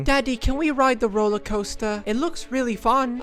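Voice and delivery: put-on high, eager little boy; child voice. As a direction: ask like an excited kid. Voicing a child Timmy.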